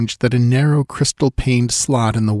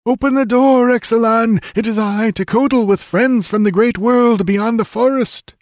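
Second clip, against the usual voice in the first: calling out; 13 semitones higher than usual. A narrator's reading. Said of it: "He lifted his voice and called".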